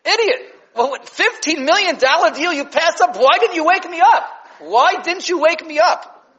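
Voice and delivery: hammed-up furious dad yelling; livid father. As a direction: rage at your son for passing up the deal. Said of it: "That's what we would say".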